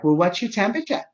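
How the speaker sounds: high-pitched imitation of a Massachusetts woman; Massachusetts accent. Phone woman asks confused patient, imitated Massachusetts accent. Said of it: "she has a Massachusetts accent".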